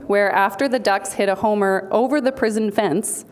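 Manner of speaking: normal talking voice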